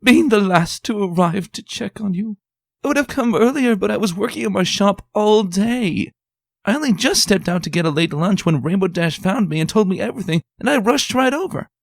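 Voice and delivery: high, loud, breathless flood of apology; gushing fussy lady. Acted. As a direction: pour out excuses in one rush. Voices Rarity apologizing.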